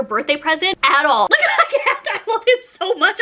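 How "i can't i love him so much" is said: much higher, laughing through the words; real giddy joy. Real, not acted. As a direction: gush while giggling. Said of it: "I'm so giddy".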